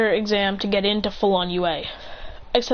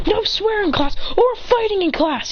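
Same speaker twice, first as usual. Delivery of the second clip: high-pitched classroom reprimand; indignant scolding; 10 semitones higher than usual. Acted. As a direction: scold them in a raised, officious pitch. Rule-keeper scolds.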